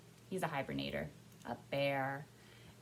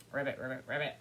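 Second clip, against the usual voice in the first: frog ribbit; 4 semitones lower, 4 dB louder, faster than usual. Shown as a demonstration. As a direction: lower ribbiting. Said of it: "What do you think frogs say?".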